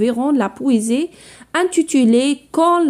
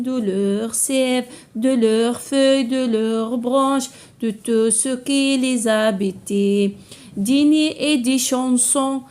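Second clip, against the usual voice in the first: slow, measured poem reading with sustained, level notes; poem recitation; 2 semitones lower, 4 dB quieter, slower than usual. Shown as a demonstration. Teacher reads the poem to pupils, slow measured recitation. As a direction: recite line by line, drawing out the words.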